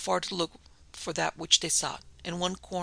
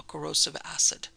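ordinary delivery

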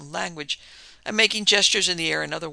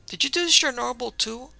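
high, louder curious question; keenly inquisitive, pitched up; 8 semitones higher, 5 dB louder than usual. A narrator's reading. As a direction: ask with eager curiosity. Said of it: "asked Benny curiously".

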